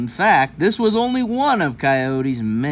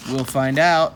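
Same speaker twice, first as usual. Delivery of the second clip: plain reading voice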